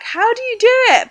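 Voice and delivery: high, loud mock question; imitating curious viewers. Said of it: "A lot of you guys are like".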